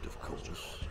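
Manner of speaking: breathy whispered aside; soft spooky whisper. A narrator's reading. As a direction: whisper it like a secret. Dark aside about the ghost.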